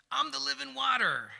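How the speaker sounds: high, booming mock announcement; loud proclamation. Jesus announcing himself to the Pharisees, loud proclamation. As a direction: shout it out to the whole crowd.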